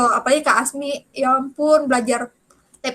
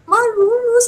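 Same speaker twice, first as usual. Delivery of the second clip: high, louder, tearful burst of good news; crying with joy; 9 semitones higher, 4 dB louder, slower than usual. Retold or imitated. Telling mum she passed, crying with joy. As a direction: choke out the good news through happy tears. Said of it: "aku kayak nangis gitu".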